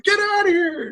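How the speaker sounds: high-pitched retold shout; exasperated shout. Bartender ejecting the banned regular, exasperated shout. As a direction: act out throwing someone out, voice way up.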